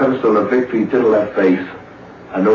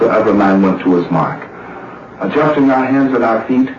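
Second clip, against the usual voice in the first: normal talking voice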